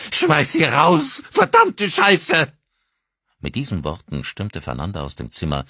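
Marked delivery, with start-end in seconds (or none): 0.1-2.5 piercing very high, very loud and slow scream; shrieking fury. A narrator's reading. Mother shrieks at husband before slamming door. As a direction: shriek it as you storm out.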